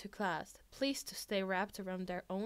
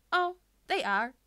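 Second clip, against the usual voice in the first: higher, louder cheerful agreement; bright agreement; 8 semitones higher, 10 dB louder than usual. A narrator's reading. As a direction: chirp it happily, unbothered.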